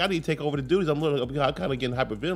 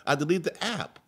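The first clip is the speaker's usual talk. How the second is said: wife's excuse; 7 semitones higher than usual. Retold or imitated. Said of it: "She's like".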